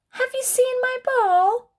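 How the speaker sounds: high, hopeful puppy question; little puppy asking eagerly. Acted. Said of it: "asks Puppy".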